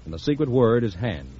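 ordinary talk, nothing marked

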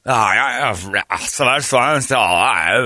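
exaggerated, drawn-out mockery; mocking drawl. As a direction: drag it out like a dopey comeback. Mocking a dim retort.

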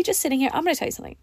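normal talking voice

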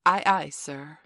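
put-on loud drill by a young captain; forceful, loud naval reply as an order; commanding model. A narrator's reading. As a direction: call it out firmly for a recruit to copy. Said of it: "Say, 'Aye, aye, sir.'".

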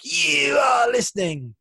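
long, high, loud drawn-out YOU; exaggerated announcer bellow; trademark intro shout. Shown as a demonstration. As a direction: holler the show opener slowly at full volume. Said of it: "do you miss my trademark".

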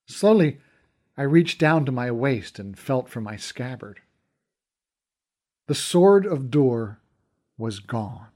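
usual speaking voice

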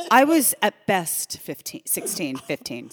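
loud, slow, laughing outrage; indignant disbelief. Real, not acted. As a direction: exclaim it through laughter.

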